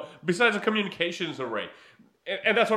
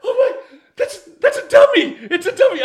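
much higher, louder, laughing disbelief; a high, loud, laughing outburst of discovery; excited shriek; 12 dB louder than usual. Retold or imitated. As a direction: squeal it out as if you can't believe it. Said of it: "Sean jumps up, he's like".

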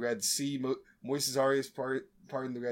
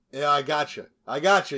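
teasing gotcha; 7 dB louder than usual. Pretend. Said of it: "You thought".

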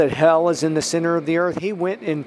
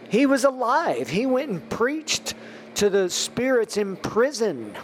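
higher-pitched, forceful emphasis on key words; emphatic preaching; 4 semitones higher than usual. Real, not acted. Podcast preacher telling listeners of Christ in Tartarus, emphatic. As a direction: preach it with rising conviction. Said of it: "I say this with emphasis".

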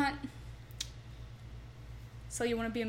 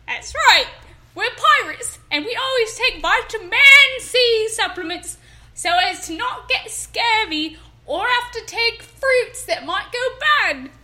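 higher, louder hammed-up stage pirate; pirate accent; 5 semitones higher, 4 dB louder than usual. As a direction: play the salty pirate hawking vitamins. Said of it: "I'm so good at accents".

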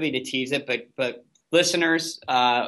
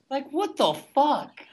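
high, slow, stunned disbelief; incredulous; 11 semitones higher, slower than usual. Real, not acted. Host reacting to Jenna's quote, high incredulous. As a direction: drag out the outrage.

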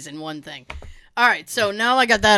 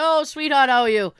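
a hint of sweet old grandfather, louder; lightly voiced old man; 5 semitones higher, 5 dB louder than usual. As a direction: lightly imitate a friendly old man greeting. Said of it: "he's a little old man".

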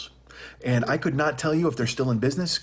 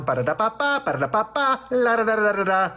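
sung high like a truck horn tune; horn jingle imitation; 8 semitones higher than usual. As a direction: toot the roach coach jingle. Imitating the roach coach horn.

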